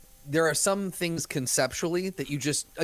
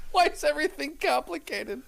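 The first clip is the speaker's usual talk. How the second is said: high mock-kid complaint; whining kid; 12 semitones higher than usual. Co-host echoing the daughter's complaint, whining kid. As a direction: imitate a child griping, pitched way up.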